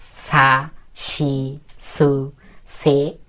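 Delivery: drawn-out syllables, one at a time; measured syllable-by-syllable model reading; reading kana aloud. Shown as a demonstration. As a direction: recite each sound separately, holding the vowel. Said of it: "এভাবে পড়বেন।".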